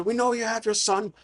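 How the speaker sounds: high, strained mock yell; acted bandit shout, pitched well up; bandit threatening. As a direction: holler threats through the door, half in jest. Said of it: "And he's knocking".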